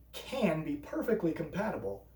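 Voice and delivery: usual speaking voice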